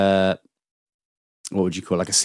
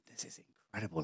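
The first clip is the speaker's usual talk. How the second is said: very soft, low-key awed aside; hushed amazement; 3 semitones lower, 24 dB quieter, much faster than usual. Retold or imitated. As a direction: say it under your breath, amazed. Awed aside in a retold scene.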